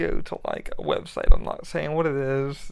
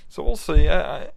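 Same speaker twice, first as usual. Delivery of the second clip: ordinary delivery, nothing marked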